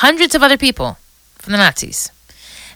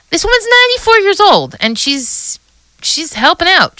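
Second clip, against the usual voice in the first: much higher, amazed exclamation; 8 semitones higher than usual. Real, not acted. Amazed at the old woman.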